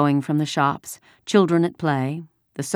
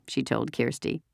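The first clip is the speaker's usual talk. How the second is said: plain reading voice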